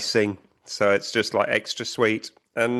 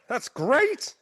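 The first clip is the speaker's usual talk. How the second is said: mock glee. Mock delight at leaf blower.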